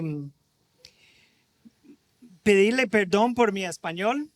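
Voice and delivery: ordinary delivery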